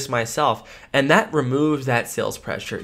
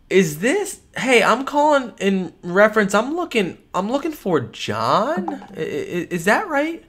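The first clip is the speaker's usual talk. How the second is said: higher, slower and puzzled; curious tone; 8 semitones higher, slower than usual. Shown as a demonstration. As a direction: ask like you're genuinely unsure.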